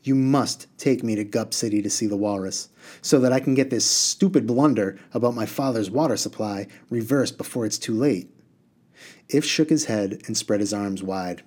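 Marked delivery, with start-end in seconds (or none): none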